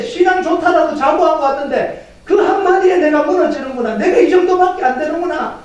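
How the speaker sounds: high-pitched preacher's lament; acted dismayed realization. Preacher voices a believer realizing their limits, acted dismay.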